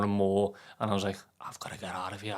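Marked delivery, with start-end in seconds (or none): 1.4-2.4 hushed, urgent inner voice; retold whispered thought. To himself, feeling closed in, whispered.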